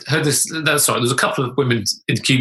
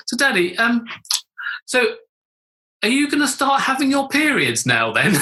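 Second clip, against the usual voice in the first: much higher daughter's voice; little voice; 10 semitones higher than usual. Retold or imitated. Daughter asking dad through toilet door, little voice. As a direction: ask it high, like an innocent child. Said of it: "this little voice from outside the door".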